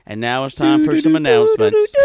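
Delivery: loud intercom announcer voice; mock principal over the PA system; school principal announcement. Imitating the school principal on the morning announcements, loud. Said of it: "when your principals say".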